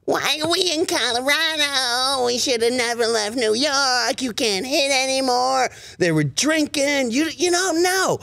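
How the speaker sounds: high, dopey excuse-making fan. Acted. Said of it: "my dopey fan voice".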